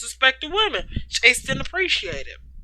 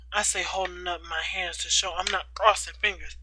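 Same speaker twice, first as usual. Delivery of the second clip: ordinary delivery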